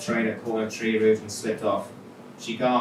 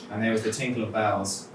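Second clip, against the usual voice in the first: usual speaking voice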